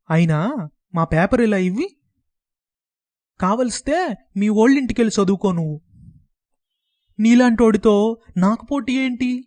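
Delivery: put-on a boy's high, loud outburst; shouting. A narrator's reading. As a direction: yell it, unable to hold back. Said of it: "గట్టిగా అరిచేశాడు". (పిల్లాడు గట్టిగా అరవడం)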